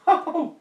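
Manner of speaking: sudden high laugh; high-pitched laugh. Real, not acted. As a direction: burst into a squeaky laugh. Laugh alone on line.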